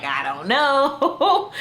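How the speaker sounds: mock-helpless wail, high and loud; freaking out. Overwhelmed woman answers his question about the stove, mock freaking out. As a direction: drag it out slowly in a hammed-up, rising fluster. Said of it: "She's just freaking out".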